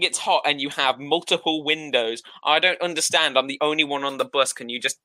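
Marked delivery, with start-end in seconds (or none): none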